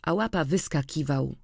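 usual speaking voice

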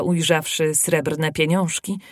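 ordinary delivery

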